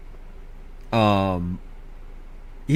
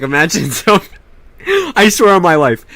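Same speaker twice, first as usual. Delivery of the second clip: ordinary delivery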